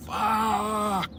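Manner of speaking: high, held-back mock scream; thin, stretched imitation of a scream; imitated shocked scream. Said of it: "Right, like a reaction".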